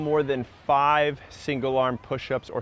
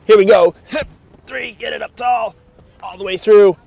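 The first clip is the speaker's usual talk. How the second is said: loud, higher, pushing through the strain; straining effort shout; 6 semitones higher, 11 dB louder than usual. Real, not acted. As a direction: grunt out the count mid-effort. Straining through a one-arm push-up.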